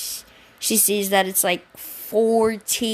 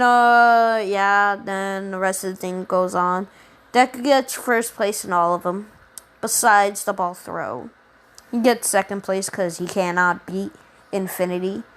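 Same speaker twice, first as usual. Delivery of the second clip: ordinary talk, nothing marked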